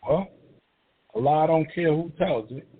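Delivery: ordinary delivery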